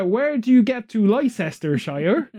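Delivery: put-on a would-be American accent drifting into Bristolian; mock American accent that slips to Bristol. Imitating an American asking directions, in a Bristol-tinged accent. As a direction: imitate an American tourist but land on a Bristol farmer's accent. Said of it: "you sound more like a farmer from Bristol".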